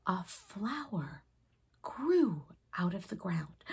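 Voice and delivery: ordinary delivery, nothing marked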